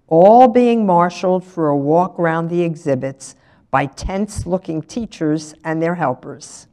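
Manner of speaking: ordinary delivery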